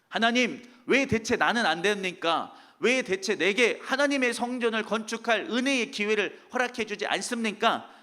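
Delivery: mock whining protest to God; grumbling complaint. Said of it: "막 투정하고 거기서 막 원망하는".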